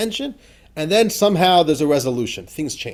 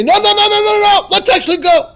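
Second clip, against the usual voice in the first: eager reversal; 11 dB louder than usual. Retold or imitated.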